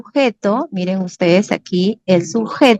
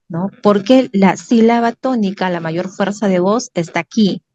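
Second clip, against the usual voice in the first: ordinary delivery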